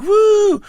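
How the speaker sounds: imitated high-pitched "woo"; a high, loud mock cheer; retold crowd whoop. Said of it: "I started yelling at the crowd".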